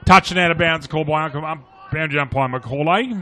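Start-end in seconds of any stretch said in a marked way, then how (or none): none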